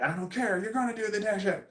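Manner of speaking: mock scream. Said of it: "got screamed at".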